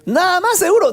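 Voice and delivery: sister's astonished high recheck; incredulous. Retold or imitated. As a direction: push it up high, still not believing. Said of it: "Ella me dijo".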